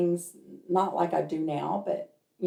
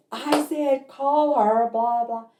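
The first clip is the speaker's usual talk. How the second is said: high, mildly raised mock yell; retold insistent yell; 7 semitones higher, 3 dB louder, slower than usual. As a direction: imitate a man repeating his order. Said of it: "I hear the man yelling again".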